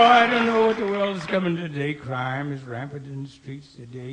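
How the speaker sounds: ordinary talk, nothing marked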